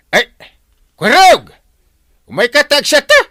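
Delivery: loud, high call across the room. Acted. Captain calling his nephew over to drink, loud and high. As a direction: shout to summon the nephew.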